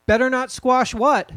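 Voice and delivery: put-on child's voice. Child asks, story read.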